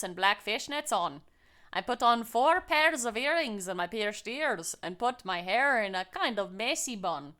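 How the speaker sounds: lilting amateur Scottish storytelling; muddled Scottish-Irish lilt; bad Scottish accent. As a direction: read it in a put-on Scottish accent sliding into Irish. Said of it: "Irish-Scottish accent slider".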